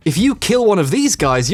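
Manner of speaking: usual speaking voice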